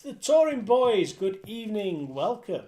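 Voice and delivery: sing-song bingo calling; bingo caller. Acted. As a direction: call the numbers out like a bingo hall. Calls bingo numbers live.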